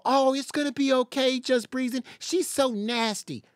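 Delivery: high, sugary mock comforting; mock sympathy. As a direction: mimic fake sympathizers cooing at her. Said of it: "have a bunch of people go".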